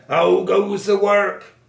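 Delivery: high, loud, drawn-out farmer's call. A narrator's reading. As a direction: call out slowly as the lazy farmer. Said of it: "expecting to hear".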